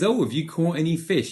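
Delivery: a cheeky jab about the empty nets; teasing. Acted. Jesus teasing the fishermen about their empty nets, playfully. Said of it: "He rubs it in a little bit".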